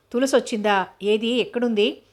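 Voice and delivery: ordinary delivery, nothing marked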